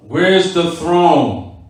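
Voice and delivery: very slow, stretched "Where's the throne"; insistent repetition. Acted. Teacher cutting off an opponent's dodge, slowly insistent. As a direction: draw out each word, refusing to be sidetracked. Insistent questioner in acted debate.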